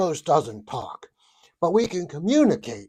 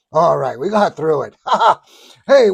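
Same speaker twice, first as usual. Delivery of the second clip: ordinary delivery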